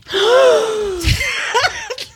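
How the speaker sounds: long, high-pitched gasp; big drawn-out gasp of shock; high gasp of disbelief; stunned gasp. Real, not acted. Hosts react to shocking revelation.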